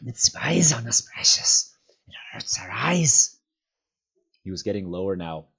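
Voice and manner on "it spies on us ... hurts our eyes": higher, louder, hissed whisper; whispered Gollum. A narrator's reading. Gollum whispering to his precious about the light. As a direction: hiss it like a creature that hates the light.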